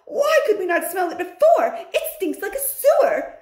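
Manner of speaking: very high, loud shrieking cackle; cackling witches. Acted. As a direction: shriek with gleeful disgust.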